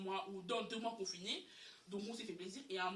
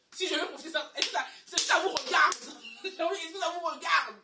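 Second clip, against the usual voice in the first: much louder and higher, laughing defiance; defiant challenge; 7 semitones higher, 11 dB louder than usual. Real, not acted. Addressing viewers defiantly about his money, loud. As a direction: demand of the viewers whether it's their business. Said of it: "defiant challenge to viewers".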